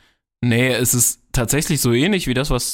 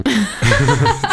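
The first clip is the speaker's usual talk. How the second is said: loud burst of laughter; 2 semitones higher, 5 dB louder than usual. Real, not acted. Laughs at joke.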